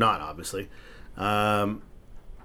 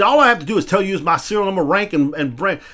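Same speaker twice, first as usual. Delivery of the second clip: raised, louder defiant rattling off; angry recital; 8 semitones higher, 5 dB louder than usual. Retold or imitated. Reciting name and rank at the cop, raised and louder in anger. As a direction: spit out name, rank and number. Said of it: "I got pissed off".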